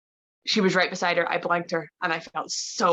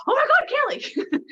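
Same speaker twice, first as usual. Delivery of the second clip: very high imitated squeal, breaking into laughter; excited recognition; 4 dB louder than usual. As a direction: squeal the name in mock delight. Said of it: "And she's like".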